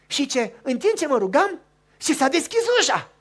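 loud, high, thrilled; rising excited storytelling; excited climax. Real, not acted. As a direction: announce the miracle.

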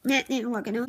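ordinary talk, nothing marked

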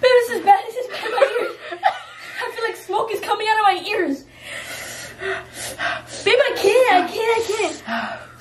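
high, teary, frantic; breaking down in pained laughter; laugh-crying. Real, not acted. Overwhelmed by spicy noodles.

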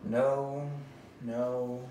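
ordinary delivery

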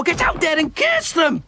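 ordinary talk

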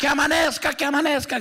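high panicked plea for dawn; desperate cry. Acted. Dracula, terrified of the sister, cries out high. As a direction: beg for sunrise in high panic.

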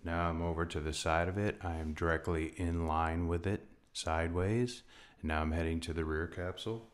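usual speaking voice

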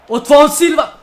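high, loud commentary shout; excited shout. Real, not acted. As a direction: call the chance out loud. Chance in front of goal.

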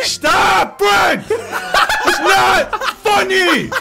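high laughing yell; laughing shout. Real, not acted. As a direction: yell it while cracking up. Yelling at friend through laughter.

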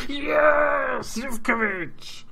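high, loud screaming yes, then drawn-out name; goal celebration. Real, not acted. Celebrating Zivkovic's goal in his game, euphoric scream. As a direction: roar the scorer's name as the ball goes in. Player scores from the corner.

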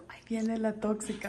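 whispered tease, low and soft; whispered playful gossip. Real, not acted. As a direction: hush it like a secret about the bird. Teasing pet, repeated twice.

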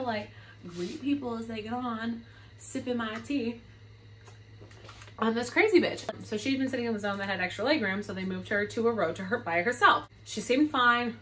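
ordinary talk, nothing marked

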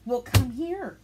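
high, loud playful voice for the dog; dog's voice. Retold or imitated. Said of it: "Expecting me to go to her".

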